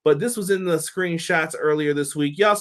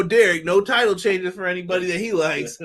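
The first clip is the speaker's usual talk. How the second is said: ordinary delivery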